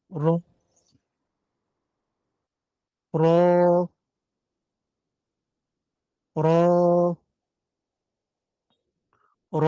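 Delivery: র with the underside of the tongue tip; teacher drilling Arabic ra; Arabic letter ra. Shown as a demonstration. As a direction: say র slowly, lifting the back of the tongue tip. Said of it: "জিহ্বার আগার উল্টা পিঠটা লেগে এভাবে উচ্চারণ হবে".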